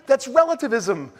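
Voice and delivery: high, laughing playful jab; teasing the audience with a laugh; joking laugh. Real, not acted. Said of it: "I'm joking".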